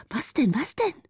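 high, anxious questioning. A narrator's reading. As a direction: press for an answer in fear. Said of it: "fragten sie in Angst". (ängstlich drängende Frage)

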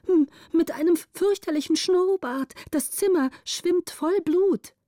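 high-pitched, stammering fright; stammer, then stretch out the horror; horrified report. A narrator's reading. (entsetzt stammelnd, mit hoher Stimme)

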